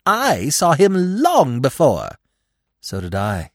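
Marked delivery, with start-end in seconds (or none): 0.0-2.2 high, loud, drawn-out boast; pettish. A narrator's reading. Squirrel outdoing the mother's account, pettish. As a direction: pettish squirrel, slow and insistent. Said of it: "the squirrel said pettishly".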